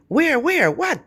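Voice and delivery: urgent search for the intruder; alarmed grandfather. Retold or imitated. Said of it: "And he's like".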